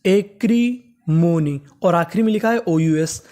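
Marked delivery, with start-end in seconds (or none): 0.0-1.6 "एक्रीमोनी" drawn out in separate chunks; very slow, split reading of "एक्रीमोनी"; syllable by syllable. Shown as a demonstration. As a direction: sound out "एक्रीमोनी" slowly, part by part. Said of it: "तो इतनी meaning बनी".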